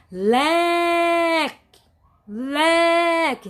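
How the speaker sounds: "Leque" with LE held long, high and loud; stressed syllable exaggerated. Shown as a demonstration. Teacher showing students the stronger syllable, LE in "Leque" drawn out forcefully. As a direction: call out "Leque", stretching the first syllable LE. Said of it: "consegue pronunciar com mais intensidade, com mais força".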